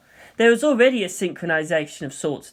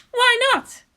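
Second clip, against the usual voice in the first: put-on fiery teen pilot snapping; Asuka's voice. Asuka challenges.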